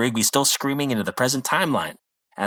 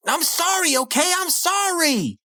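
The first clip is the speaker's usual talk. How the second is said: high, whiny begging; mock pleading Rigby; slower than usual. Rigby freaking out, pleading with the wizard in a high whine. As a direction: beg like a panicked kid. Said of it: "pleading with the wizard".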